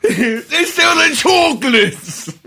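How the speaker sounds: high, loud, drawn-out cartoon yell; hammed-up cartoon shout; SpongeBob reference. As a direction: holler it slowly, half laughing. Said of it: "It's a SpongeBob reference".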